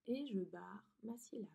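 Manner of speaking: soft teacher whisper to herself. Shown as a demonstration. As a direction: murmur it as you cross out. Maîtresse montre, voix chuchotée. (chuchoté en barrant)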